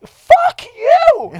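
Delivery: very high, loud screamed comeback; retold yell. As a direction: scream it back, hammed-up. Yells back in retold quarrel.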